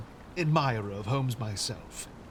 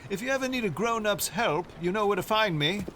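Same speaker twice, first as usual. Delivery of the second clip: calling out; 7 semitones higher, 4 dB louder than usual. Acted. Teacher calls back while leaving the children.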